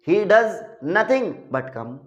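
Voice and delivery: very slow, "does" leaned on with a falling tune; emphasis on does. Shown as a demonstration. Teacher showing "does nothing but", slow emphatic "does". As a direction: drag it out and stress "does". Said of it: "emphasise दबाव डालकर की जाए".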